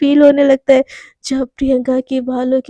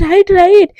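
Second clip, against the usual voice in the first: higher, louder "right, right"; repeating louder to be heard; 5 semitones higher, 6 dB louder than usual. Acted. Back-seat passenger repeating directions to the driver, raised voice. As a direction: raise your voice so the driver hears. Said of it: "मुझे सुनाई नहीं दे रहा है".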